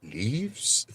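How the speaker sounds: high, soft questioning rise; confused. Retold or imitated. Confused witness guessing an answer for the lawyer, baffled. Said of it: "He was, like, so confused".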